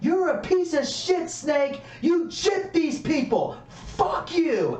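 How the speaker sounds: retold yell. Wrestler cutting a promo on Jake, retold yell. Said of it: "he basically yells at him and goes".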